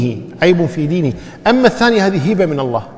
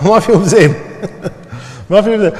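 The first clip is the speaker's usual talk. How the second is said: laughing; 3 semitones higher than usual. Real, not acted. Teacher jokes about two liars.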